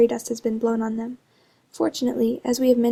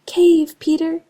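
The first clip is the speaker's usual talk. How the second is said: much higher and louder warning call; 5 semitones higher, 7 dB louder, much slower than usual. A narrator's reading. Smallest star warning Peter, calling out loud. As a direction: shout the warning up to him. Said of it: "called out".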